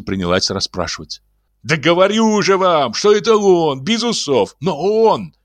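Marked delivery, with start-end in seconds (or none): 1.6-5.3 shouting. A narrator's reading. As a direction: shout it, as he always does. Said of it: "по обыкновению кричал".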